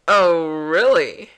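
loud, slow, drawn-out "Oh, really"; exaggerated disbelief. Repeating the girl's reply to the hero, slow hammed-up disbelief. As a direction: stretch it out and let the tune fall.

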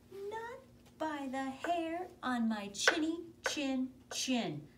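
high, slow, stubborn little pig; little pig refusing. Acted. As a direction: squeak it out, standing firm.

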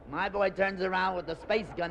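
ordinary talk

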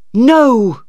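a high loud cry of protest; shouted. Acted. Stephen shouting his protest at Maggie. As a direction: fling out the no. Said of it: "Stephen shouted".

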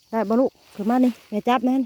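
loud call to his daughter to climb up. Real, not acted. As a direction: call her over from a distance. Father calling daughter to climb up. (เรียกลูกเสียงดัง)

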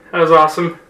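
ordinary delivery

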